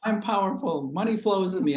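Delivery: high, pumped-up self-talk; hyped affirmations. Retold or imitated. Jacked-up agent reciting affirmations at his desk, mock pumped. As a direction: chant it like a coffee-fuelled pep talk. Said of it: "doing my affirmations".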